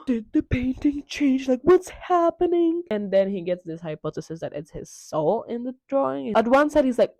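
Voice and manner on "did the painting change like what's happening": high, slow theatrical dismay; mock alarm. Dorian seeing the painting change, hammed-up alarm. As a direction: stretch out the horrified question playfully.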